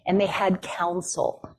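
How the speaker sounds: ordinary talk, nothing marked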